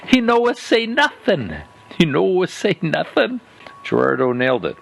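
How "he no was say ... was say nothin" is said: put-on high, broken-English Italian accent with comedic chagrin; Italian-accented disbelieving quip; thick Italian accent. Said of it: "In that spaghetti sauce thick Italian accent".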